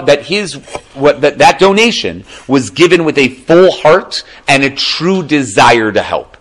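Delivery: ordinary talk, nothing marked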